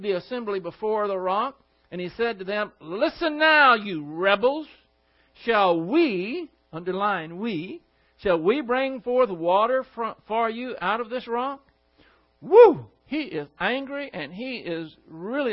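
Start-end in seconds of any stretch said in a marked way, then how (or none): 2.8-11.6 loud, higher, scolding rebuke; angry rebuke. Acted. Moses rebuking the people angrily. 12.4-12.9 sharp, loud, high whoop. Real, not acted. Reacting to Moses' anger.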